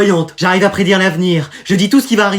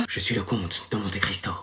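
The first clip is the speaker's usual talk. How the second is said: dazed murmur; 10 semitones lower, 15 dB quieter than usual. Acted. Brain-damaged boy after menhir.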